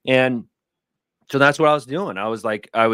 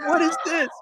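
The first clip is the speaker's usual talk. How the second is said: surprised. Retold or imitated. Reacting to buddy's papers.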